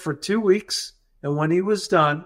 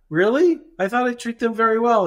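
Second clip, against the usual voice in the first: higher-pitched retold disbelief; taken aback; 7 semitones higher than usual. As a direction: play the doctor's surprised protest, pitched up. Doctor hears staff are hurt.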